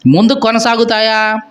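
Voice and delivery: ordinary talk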